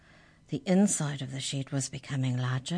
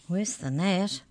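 ordinary delivery, nothing marked

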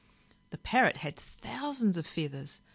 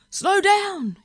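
high, loud shout of alarm; yelling; 11 dB louder than usual. A narrator's reading. Passengers alarmed at the speeding bus, yelling. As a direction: yell at the driver to brake. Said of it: "yelled the passengers".

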